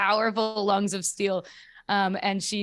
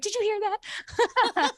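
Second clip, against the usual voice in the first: high, excited mom voice; much higher, delighted mock mom; imitating mom; faster than usual. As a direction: squeal it like a thrilled mother. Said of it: "She's like".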